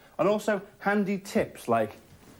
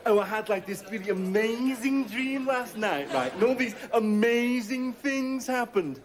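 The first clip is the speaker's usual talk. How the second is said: mock gushing; 10 semitones higher than usual. Someone recounting last night's dream, mock gushing. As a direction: mimic an over-excited dream teller.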